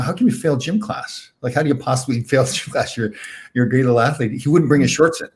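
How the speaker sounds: ordinary delivery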